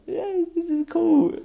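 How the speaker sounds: high, slow, put-on contentment; mock blissed-out cheer. Man waving to people, mock blissed-out high voice. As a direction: stretch it out high, like a happy daze.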